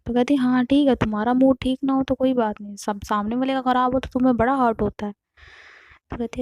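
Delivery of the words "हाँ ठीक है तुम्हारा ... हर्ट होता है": higher, louder mock-hurt jab; sarcastic retort. Aarti taunting Navya over moods, higher and louder sarcasm. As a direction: throw her words back at her.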